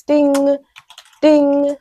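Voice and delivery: high "Ding, ding" with a little pause between; spaced mock notification dings; three dings. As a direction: chime twice like a phone going off. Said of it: "three dings".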